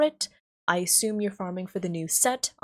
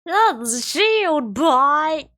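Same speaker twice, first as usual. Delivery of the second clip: slow, even louder, higher cry of despair; louder dramatic wail; 7 semitones higher, 7 dB louder, much slower than usual. Acted. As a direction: wail it as the final blow. Dismayed wail at build.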